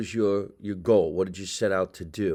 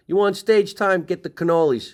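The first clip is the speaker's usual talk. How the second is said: higher, louder playful demand; mock bribe; 8 semitones higher, 6 dB louder, faster than usual. Host mimics booker's deal, higher, louder and teasing. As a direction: push the deal like a comic bribe. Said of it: "What a bribe".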